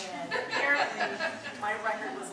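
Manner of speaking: normal talking voice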